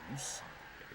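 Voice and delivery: ordinary talk, nothing marked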